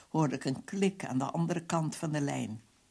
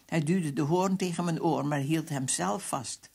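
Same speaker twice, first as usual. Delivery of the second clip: plain reading voice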